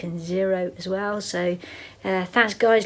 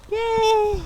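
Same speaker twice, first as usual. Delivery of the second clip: high, drawn-out happy squeal; excited cheer; 12 semitones higher than usual. Real, not acted. Vlogger seeing Loch Lomond below, high excited cheer.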